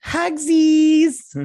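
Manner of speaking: put-on high, loud baby-talk squeal; squealed mock cutesy. Narrator mocking the crew's group hug, squealed. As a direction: squeal it like a cloying toddler.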